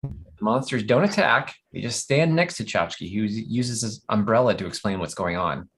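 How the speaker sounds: ordinary delivery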